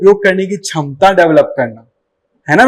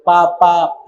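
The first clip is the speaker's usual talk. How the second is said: put-on baby babble; 3 semitones lower, 4 dB quieter, much slower than usual. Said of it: "बच्चा जो कि words pronounce नहीं कर पाता था".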